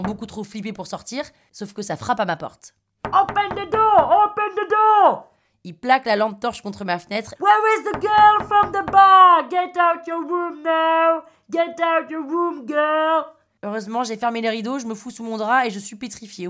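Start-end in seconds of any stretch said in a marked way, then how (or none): 3.1-5.3 high, loud, drawn-out yells; shouting Russian. Retold or imitated. Russian outside the hotel room at night, slow high shouting. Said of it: "je reconnais la voix du Russe". 7.4-13.2 high, long-drawn holler; Russian man yelling. Retold or imitated. Russian hunting the girl room by room, drawn-out yelling. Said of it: "partent en hurlant comme des gros dégueulasses".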